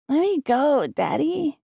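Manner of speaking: put-on high, childlike whine; a small boy squirming free; little boy voice. A narrator's reading. Child voice in story.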